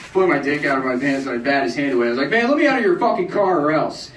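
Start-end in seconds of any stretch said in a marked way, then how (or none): 2.3-4.1 high, pushed threat; tough threat. Retold or imitated. Drunk comic threatening the groping driver, high bluff. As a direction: bluff like you could fight.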